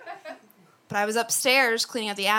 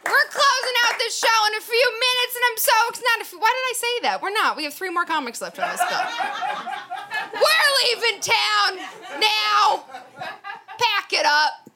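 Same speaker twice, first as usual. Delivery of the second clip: high, loud announcer hype; hype host; 13 semitones higher, 5 dB louder than usual. Acted. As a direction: pump up the crowd like an MC. Host hyping the crowd.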